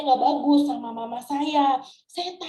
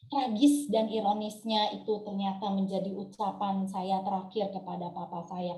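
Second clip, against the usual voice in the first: ordinary talk, nothing marked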